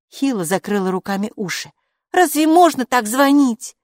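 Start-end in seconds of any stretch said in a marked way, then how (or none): 2.1-3.8 high, loud protest; indignant child. A narrator's reading. Hilla covers her ears at the ringing, loud indignant protest. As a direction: protest it with raised pitch and volume.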